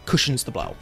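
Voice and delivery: ordinary talk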